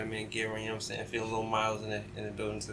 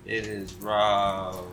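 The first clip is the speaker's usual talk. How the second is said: announcer intro; 3 semitones lower, much slower than usual. Acted. Announcing the co-host.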